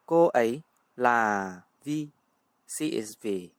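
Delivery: ordinary talk, nothing marked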